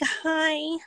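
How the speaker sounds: mock the hopeful wife calling her man; wife calling cheating husband, high and sweet; sweet wife on the phone. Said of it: "You'd be like".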